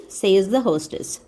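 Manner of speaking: ordinary delivery, nothing marked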